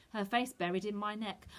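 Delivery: plain reading voice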